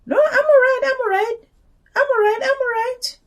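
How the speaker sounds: very high-pitched, repetitive mimicry; imitated polite refusal. Turning down the offered seat on the train, high insistent mimicry.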